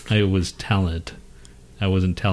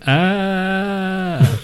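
death cry; 11 semitones higher, 3 dB louder than usual. Retold or imitated. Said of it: "he just went".

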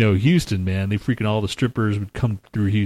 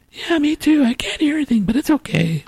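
mock reading a listener comment. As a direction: read the comment in a silly high voice. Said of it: "now I have to read all this bullshit".

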